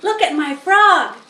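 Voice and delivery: put-on high, loud eager boy; excited boy voice. A narrator's reading. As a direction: show off the frog. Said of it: "said Avery".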